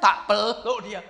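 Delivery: ordinary talk, nothing marked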